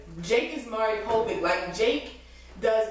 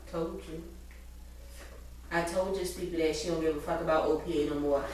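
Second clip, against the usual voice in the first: muttered; 4 semitones lower, 8 dB quieter than usual. Retold or imitated. Charlie's muttered told-you.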